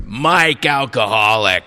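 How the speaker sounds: a hint of a slow, gruff old man; lightly voiced gruff Mike. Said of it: "the guy calls in here".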